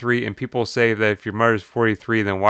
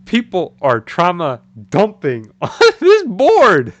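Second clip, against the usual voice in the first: dramatic slow repeat; 6 semitones higher, 5 dB louder, slower than usual. Real, not acted.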